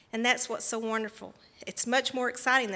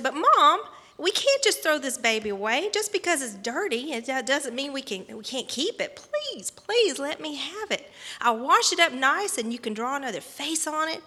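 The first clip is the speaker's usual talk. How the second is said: begging mom, higher and louder at first; pleading child; 4 semitones higher, 5 dB louder than usual. Retold or imitated. Girl begging Mom to keep a doll, pleading. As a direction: plead eagerly, stretching out the begging words. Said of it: "she really wanted this doll".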